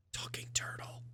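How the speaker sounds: put-on hushed, voiceless kid's wonder; awed whisper. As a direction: whisper it in amazement. Kid marvels at turtle stranger.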